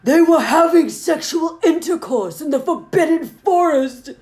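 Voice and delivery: loud, high outraged yell; furious voice. Acted. Headmaster yelling about the couple in the forest, furious. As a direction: shout it in fury, hitting each stressed word. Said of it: "he yelled in a furious voice".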